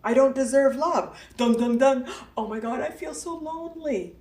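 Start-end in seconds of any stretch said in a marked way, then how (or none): 2.4-4.2 mock lonely lament. Acting out a sad discovery.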